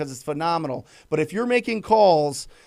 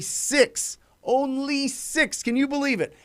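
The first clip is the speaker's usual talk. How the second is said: much higher and slow on "only six"; amazed emphasis; 8 semitones higher, slower than usual. Real, not acted. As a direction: sell the number as astonishing. Stresses surprising statistic.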